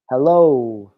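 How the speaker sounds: a firm, long, low hello. Shown as a demonstration. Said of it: "and a little louder".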